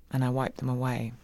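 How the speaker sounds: usual speaking voice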